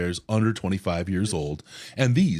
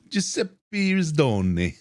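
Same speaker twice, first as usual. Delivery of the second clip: hammed-up Italian name, slow and higher, Rizdone rolled out; mock Italian; 7 semitones higher, much slower than usual. Host introducing his co-host in mock Italian. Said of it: "I've never been Italian before".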